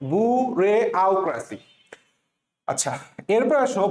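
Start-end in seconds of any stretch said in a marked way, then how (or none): 0.1-1.6 "বু রে আও ক্র্যাসি" in four separate pieces; boo, ray, au, cracy, each set apart; syllable-split pronunciation. Shown as a demonstration. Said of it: "এখন থেকে কি উচ্চারণ করবো?".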